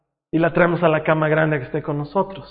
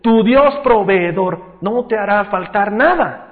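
raised, higher preacher voice; fervent preaching; 6 semitones higher, 6 dB louder than usual. Real, not acted. Preacher assuring the jobless that God provides, fervent preaching. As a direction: declare it with full conviction.

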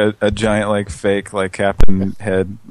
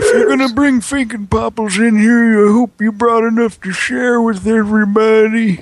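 squeaky high-pitched cartoon character; 13 semitones higher, 4 dB louder than usual.

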